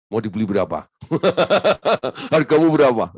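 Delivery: mock haggling. Mock haggling with proud listener.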